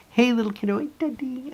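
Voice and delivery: put-on high, soft baby talk; sing-song it like a toddler at play; toddler greeting. Dad and daughter greeting exchange.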